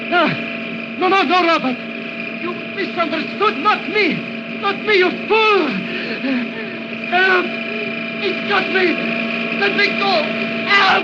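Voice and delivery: panicked cries for help. Acted. Doctor crushed by his own robot, panicking and screaming. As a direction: scream as the machine crushes you.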